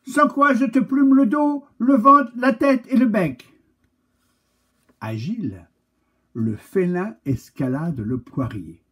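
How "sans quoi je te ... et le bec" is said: high, loud cat threat; the cat threatens to pluck him; threatening cat. Acted.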